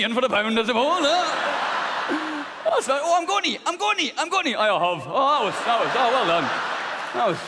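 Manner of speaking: put-on high, loud polite Scottish accent; a prim Scottish man cheering on gently; genteel Scottish porn. A polite Scottish porn actor praising his partner, loud high Scottish accent. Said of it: "Scottish porn".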